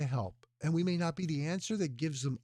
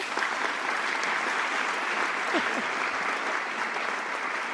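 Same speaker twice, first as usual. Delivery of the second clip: laugh; 11 semitones higher, 4 dB louder than usual. Real, not acted. Greeting a viewer in chat.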